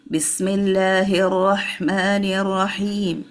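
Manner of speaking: slow recitation, long vowels held in "Bismillahir Rahmanir Rahim"; measured "Bismillahir Rahmanir Rahim", drawing out each long vowel; basmala recitation demo. Shown as a demonstration. Teacher showing timing in the basmala, slow recitation. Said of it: "And then you are saying".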